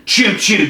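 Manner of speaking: high-pitched mock choo-choo; train whistle. Storyteller riding a train to hell, imitated train whistle. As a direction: toot like a train.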